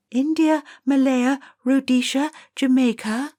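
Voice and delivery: put-on high, bright-eyed girl rattling off countries; eager schoolgirl. Girl answers the teacher's question, high and eager. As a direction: recite the list keen to please.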